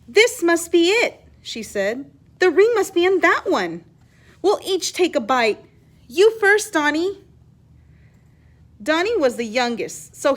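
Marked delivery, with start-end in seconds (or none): 0.1-1.1 excited. A narrator's reading. Maria excited about the ring. 2.4-7.2 excited. A narrator's reading. Maria urging cousins to bite.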